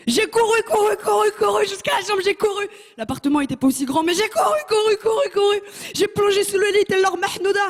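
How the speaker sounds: high, breathless, panicked rush; racing away in panic; frantic running. Retold or imitated. Frantic retold flight. (fuite paniquée, voix très aiguë)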